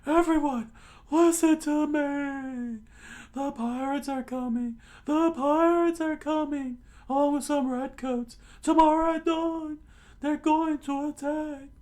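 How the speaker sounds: loud, very high falsetto shouting; squeaky alarm cried out to a whole town; frantic warning. Acted. Usopp warns townspeople that pirates are coming, frantic high shout.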